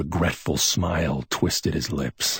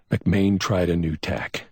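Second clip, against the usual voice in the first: usual speaking voice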